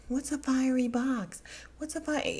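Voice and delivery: high, puzzled question; baffled, looking around the table; confused. Retold or imitated. The women at dinner, high and baffled.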